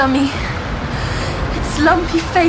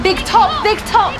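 higher-pitched call of "Big Top"; mock shout; 7 semitones higher, 3 dB louder than usual. Patient retelling the shout at the circus, mock shouting. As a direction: shout it out over a circus crowd. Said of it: "That's when I shouted".

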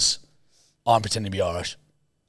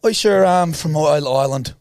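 louder, higher, shaky-brogue Irish act; slipping Irish accent; 5 semitones higher, 5 dB louder than usual. Acted. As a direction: bluff a failing Irish accent. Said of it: "losing a bit of the act".

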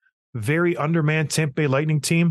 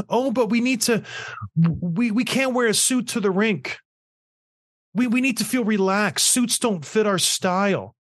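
high, mock-whiny spoiled player voice; hammed-up whining of pampered players; 5 semitones higher than usual. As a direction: imitate a spoiled athlete begging, nasal-free but whiny. Mocking players' demands.

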